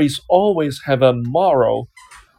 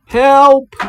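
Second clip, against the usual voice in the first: loud cry for help; shouting for help; 10 semitones higher, 10 dB louder than usual. A narrator's reading. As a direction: yell it out, desperate. Lion trapped in a net.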